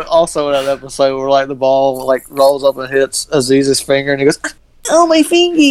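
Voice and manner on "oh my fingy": put-on much higher, babyish whimper; whiny baby voice. Imitating Aziz's character whining over a hurt finger, high baby voice. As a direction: whine it like a toddler with a hurt finger.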